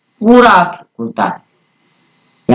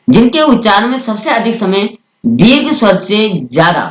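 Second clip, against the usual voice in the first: normal talking voice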